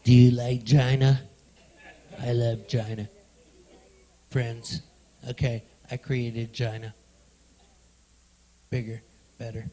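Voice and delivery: Trump impression. Comic boasting to the crowd in a low impression voice. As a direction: puff up like a bragging president. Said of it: "That was my attempt at an impression".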